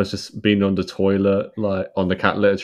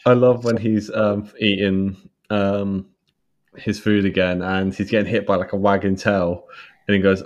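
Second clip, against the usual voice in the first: usual speaking voice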